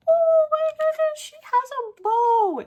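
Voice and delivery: high, loud gushing over something cute; delighted squeal. Real, not acted. Crafter opening swap mail, squealing with delight.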